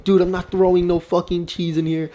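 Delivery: exasperated refusal, played up; mock protest. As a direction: push back on pestering friends. Retold refusal to his friends.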